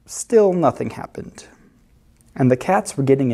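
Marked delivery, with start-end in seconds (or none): none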